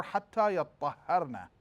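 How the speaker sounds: yattahharna reading, doubled ta and ha; "يَطَّهَّرْنَ" with both ta and ha doubled and stressed; Quran recitation. Shown as a demonstration. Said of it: "We have two reading".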